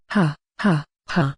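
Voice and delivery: big bursting laugh; explosive laughter. Acted. Orc bursting into laughter at the frightened elf's reaction. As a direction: burst out laughing at the scared elf. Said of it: "I explode into laughter at his reaction".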